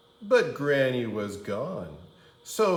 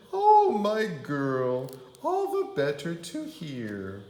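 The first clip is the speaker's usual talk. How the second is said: high, laughing fake granny voice; wolf as granny; 6 semitones higher than usual. Wolf as Grandma laughing off Red's question. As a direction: chuckle through the granny act.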